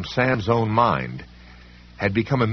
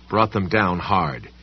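plain reading voice

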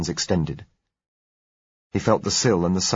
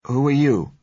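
higher, louder uneasy question; uncertain; 8 semitones higher, 5 dB louder than usual. A narrator's reading. Frightened doctor to a stranger in the corridor, uncertain. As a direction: ask it loudly but unsure of who is there. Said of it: "he asked uncertainly".

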